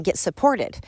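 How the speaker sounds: ordinary talk, nothing marked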